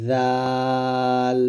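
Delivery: জাল drawn out long and slow, tongue tip to upper teeth; Arabic letter demo. Shown as a demonstration. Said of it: "পাতলা করে". (জাল ধীরে টেনে উচ্চারণ)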